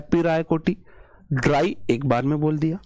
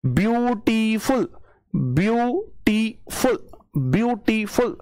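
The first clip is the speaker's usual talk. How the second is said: Beautiful broken slowly into view, टी, फुल, pitched up; pauses between syllables; 5 semitones higher, slower than usual. Shown as a demonstration. Teacher proving the word has breaks, beautiful split syllable by syllable. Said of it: "कहीं ना कहीं मैं pause ले रहा हूं".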